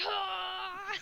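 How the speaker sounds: mock battle cry. Running at the door, crying out.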